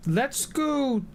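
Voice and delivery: sudden high squeak. Real, not acted. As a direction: jump way up in pitch.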